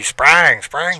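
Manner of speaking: "sprang" drawn out with a southern drawl on the vowel; lightly imitated Southern "sprang". Shown as a demonstration. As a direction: say "sprang" like country Southern folk. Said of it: "think of a southern drawl".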